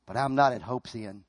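low, softened, settled conviction; hushed, certain faith after the outburst; calm assurance. Acted. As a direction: drop low and quietly reassure. Answers despair with calm assurance.

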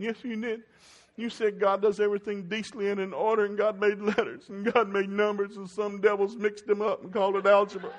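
put-on high, loud whiny teen daughter; daughter pleading. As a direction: squeal it like a teary teen girl. Daughter's excuse voiced, crying.

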